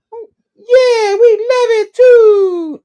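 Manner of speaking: very high, loud, drawn-out squeal; hammed-up drunken falsetto cheer; drunk weird lady. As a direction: whoop it slowly like a tipsy woman. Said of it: "she's kinda drunk".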